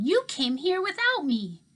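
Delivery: a little brother stamping his foot, accusing; stamping boy's complaint. A narrator's reading. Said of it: "He stamped his foot".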